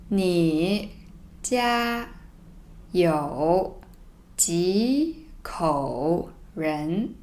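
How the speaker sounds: slow, separated words; reading slowly word by word. Shown as a demonstration. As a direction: read each word apart, very slowly. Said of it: "So let me read it slowly first".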